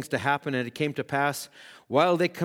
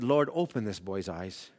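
Elijah's prayer; 3 semitones lower, 5 dB quieter than usual. Retold or imitated. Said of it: "and Elijah said".

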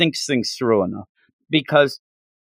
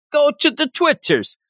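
"Go to the Twitters" jumped much higher, playful; high goofy plug; 14 semitones higher than usual. Shown as a demonstration. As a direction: repeat the plug in a silly high voice. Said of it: "repeated plug".

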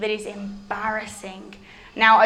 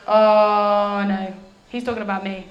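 dawning dread; 3 semitones lower than usual. Acted. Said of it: "she's thinking".

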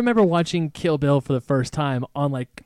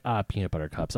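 mom's mild cursing; 10 semitones lower, 10 dB quieter than usual. Retold or imitated. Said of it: "her way of cursing was like".

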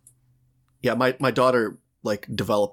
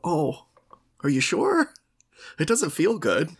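tentative doubt; 6 semitones higher, slower than usual. Retold or imitated. Retelling own doubtful reply to friend.

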